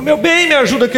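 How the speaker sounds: light imitation of wife after a fall; wife calling for help. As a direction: mimic the wife asking for help. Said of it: "Aí ela virou e falou".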